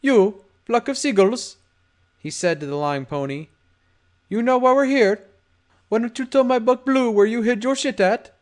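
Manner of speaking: a lightly voiced smooth gangster; tough guy voice.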